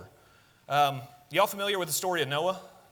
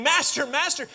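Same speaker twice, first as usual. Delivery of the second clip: high, louder frantic cry of Master; panicked pleading; 10 semitones higher, 6 dB louder than usual. Retold or imitated. Virgins realizing they are late, crying out in panic. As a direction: call out in panic at the locked door. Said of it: "you can almost hear the panic".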